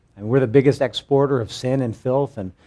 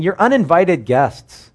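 higher, louder and slow; mock indignant God; 7 semitones higher, 6 dB louder, slower than usual. Preacher voicing God rebuking the temple crowd, high and slow. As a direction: spell out the offence, slow and pointed. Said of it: "God's like saying".

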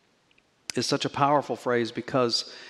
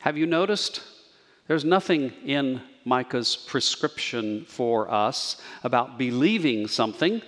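normal talking voice

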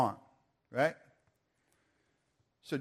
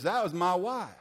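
put-on higher-pitched Georgia accent; Southern accent; 4 semitones higher than usual. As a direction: imitate an excited Georgia man's accent. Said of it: "I'll just do my English now".

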